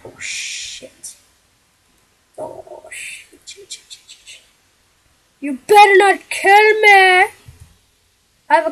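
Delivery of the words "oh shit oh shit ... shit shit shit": whispered swearing, getting fainter; panicked whisper. Real, not acted. Player hiding from enemies, swearing in a panicked whisper. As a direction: breathe the curses, terrified of being heard.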